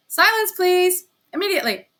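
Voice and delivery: teacher calling the hall to order; stern command. Acted. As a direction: demand silence from the class.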